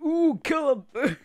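high slow squeal; squeaky cartoon voice. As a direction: stretch the ooh in a high squeak. Squealed catchphrase.

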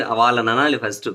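ordinary talk

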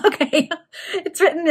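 amused laugh then okay. Real, not acted. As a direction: laugh at the slip. Reader laughs at misreading.

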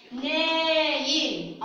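ناين slowly as a long "naa" then "yin"; قراءة تعليمية. Shown as a demonstration. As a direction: stretch the first vowel of ناين. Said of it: "أو التنوين بالكسر". (نطق «نايٍ» ببطء مع مدّ الألف)

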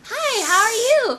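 put-on high, drawn-out childish greeting; mock-innocent high kid voice; chirpy little kid. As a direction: play an eager child saying hello. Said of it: "I'm like".

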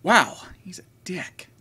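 unvoiced whisper under the breath; whispered aside. Real, not acted.

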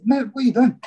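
mock indignant cry, pitched high; retold indignant question. Retelling to the interviewer how he caught his roommate, indignant high cry. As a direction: demand an answer in retold disbelief. Confronting teammate over empty Coke bottles.

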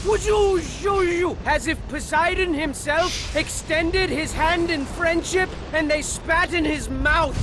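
loud wind and thunder effects; shouted storm noises. Acted. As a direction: belt out the storm like a cartoon. Storm returns louder.

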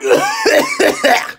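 sudden high squealing laugh; high laugh at the sourness. Real, not acted. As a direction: laugh out at the sour shock. Both flavors together, very sour.

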